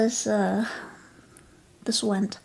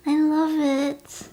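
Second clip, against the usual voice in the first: usual speaking voice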